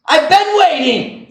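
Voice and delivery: high, slow fed-up complaint; sarcastic groan at being told to wait; exasperated. Retold or imitated. Exasperated retort at bank clerk.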